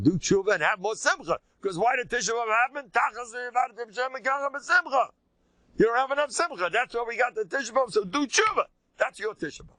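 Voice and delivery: high, impassioned preaching; worked-up rabbi exhorting; fervent exhortation. Real, not acted. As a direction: drive it home at a sustained high pitch. Exhorting the audience with force.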